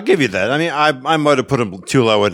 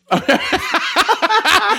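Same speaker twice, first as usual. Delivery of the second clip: high laugh. Real, not acted. Laughing at Batman slip.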